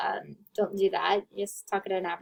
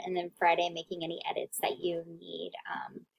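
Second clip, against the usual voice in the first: whispering; 3 semitones lower, 8 dB quieter than usual. Real, not acted. Teacher slips into whisper.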